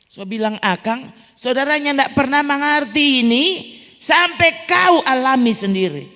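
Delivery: loud, high preacher's voice pressing the point; full-force sermon delivery; fervent preaching. Acted. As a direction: drive it home to the congregation.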